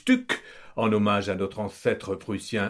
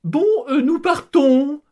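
wife's voice; 8 dB louder than usual. Acted. Wife announcing departure to family, high loud voice. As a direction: squeak out the decision. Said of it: "reprend Kitry".

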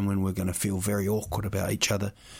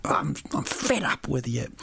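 mock angry; 3 semitones higher than usual. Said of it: "eat it angrily".

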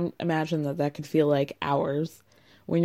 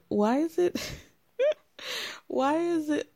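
higher-pitched, laughing through the words; cracking up; 9 semitones higher, 4 dB quieter than usual. Real, not acted. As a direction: try to ask it while breaking into giggles. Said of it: "I crack up the whole time".

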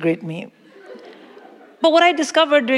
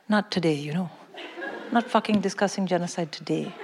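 quiet inner voice; 4 semitones lower, 10 dB quieter than usual. Retold or imitated. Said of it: "I thought to myself".

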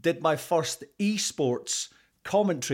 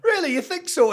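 much higher, louder mock-astonished reaction; delighted surprise; 7 dB louder than usual. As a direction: play up being flattered and amazed, pitch leaping up. Acting out own reaction to praise.

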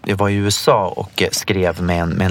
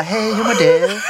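playful imitation of a sweet hello, higher and laughing; mock polite greeting; 9 semitones higher, slower than usual. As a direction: tease the friend with a girly hello. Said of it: "Du går inte fram och bara".

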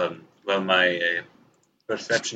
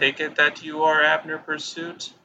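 much higher, loud, stately character voice; formal character greeting; 8 semitones higher, 4 dB louder than usual. Acted. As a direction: greet an old acquaintance with grand courtesy. Player voices character greeting Cairo.

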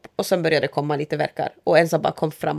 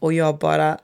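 ordinary delivery

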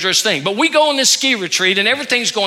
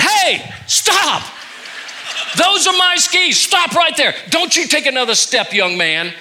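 angry shout; 7 semitones higher than usual. Retold or imitated. Pastor shouting at a teen grabbing his skis, furious yell. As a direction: stop him in his tracks.

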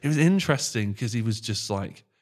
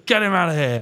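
high shrieking laugh, then louder mock order; mock call to throw him out; joking shout; 6 semitones higher, 6 dB louder than usual. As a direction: yell it like a bouncer, laughing. Joking about a cokey fan.

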